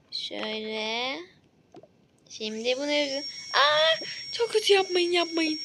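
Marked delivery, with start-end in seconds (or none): 3.5-5.6 higher, pleading protest; patient begging the dentist; frightened plea. Acted. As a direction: beg them to stop. Patient cries out at tool.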